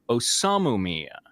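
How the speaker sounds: ordinary delivery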